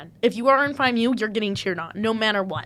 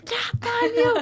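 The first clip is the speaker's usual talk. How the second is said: high-pitched mock yell; high, excited team cheer; retold scream; 12 semitones higher, slower than usual. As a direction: imitate screaming for the team without full volume. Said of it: "I'd start screaming".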